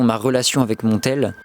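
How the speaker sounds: ordinary talk, nothing marked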